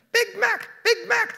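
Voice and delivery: piercing, very high, loud crow-parrot squawk. A narrator's reading. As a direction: screech it like a raucous parrot. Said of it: "squawking so piercingly".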